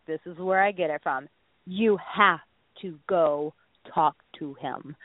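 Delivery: ordinary talk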